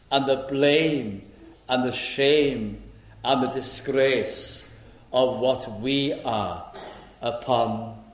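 slow, weighty, each phrase laid down; solemn preaching. Acted. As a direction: let every word of the burden land slowly. Slow solemn sermon point.

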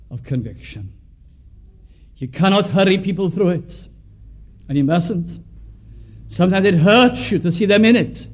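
normal talking voice

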